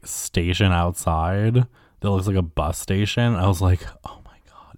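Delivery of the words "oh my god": breathy hushed oh my god; whispered awe. Retold or imitated. Whispering in awe at the airport smoking station. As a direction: hush it like you found treasure.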